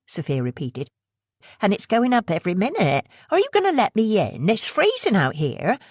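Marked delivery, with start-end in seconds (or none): 1.6-5.8 pushy West Country woman. Acted. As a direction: rush the sales pitch in a West Country accent. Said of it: "the accent heavy West Country".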